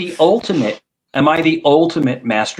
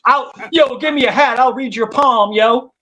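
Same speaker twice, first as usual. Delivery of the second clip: much higher, lightly put-on street psychic; 8 semitones higher than usual. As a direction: pitch it up like a hustling palm reader.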